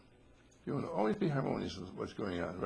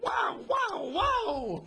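excited shout; 5 dB louder, much slower than usual. Pretend. Imagined sweepstakes win joy.

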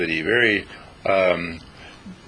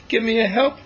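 smiling little bird; 11 semitones higher than usual. Acted. The bird just looked at the cat and smiled.

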